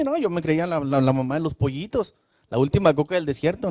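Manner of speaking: ordinary talk, nothing marked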